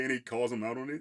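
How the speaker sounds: ordinary talk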